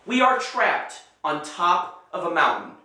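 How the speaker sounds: ordinary delivery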